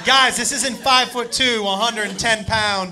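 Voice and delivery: ordinary talk, nothing marked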